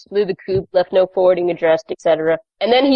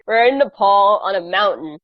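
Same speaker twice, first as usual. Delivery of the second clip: normal talking voice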